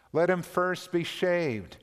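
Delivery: witch's order. A narrator's reading. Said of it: "said the witch".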